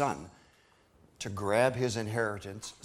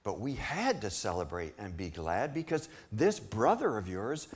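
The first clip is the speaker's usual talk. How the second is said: joyful father; 8 semitones higher than usual. Acted. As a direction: let the joy lift the voice high. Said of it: "the father said".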